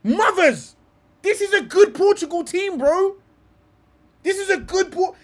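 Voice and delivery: high and loud, hyped up; excited. Real, not acted. Proud of his Portugal lineup, shouts excitedly.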